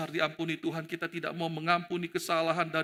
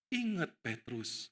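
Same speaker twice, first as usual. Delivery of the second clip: slow, soft, low warning; Yesus; 4 semitones lower, 7 dB quieter, slower than usual. Acted. Jesus reminding Peter, soft grave warning. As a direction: warn him softly and slowly. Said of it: "Yesus itu pengen ngomong dengan Petrus".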